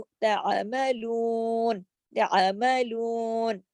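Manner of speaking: तामलून with the लू stretched long; slow recited तामलून; drawn-out long vowel. Shown as a demonstration. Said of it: "मद का काम कर रहा है".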